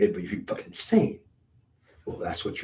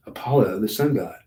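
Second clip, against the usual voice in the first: louder, proud announcement; emphatic; 2 semitones higher, 7 dB louder than usual. Real, not acted. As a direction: proclaim the name. Proudly announcing his past.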